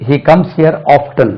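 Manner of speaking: often as OF-tun in a slow sentence; T pronounced. Shown as a demonstration. Teacher models the alternative for learners, often with audible T. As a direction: sound the T in often. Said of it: "often கூட உச்சரிக்கலாம் இது".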